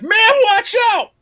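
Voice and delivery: high, loud sudden yell; warning shout. Retold or imitated. Haunt actor warning a couple, high shout. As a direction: shout a warning to scare them. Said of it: "I'd be like".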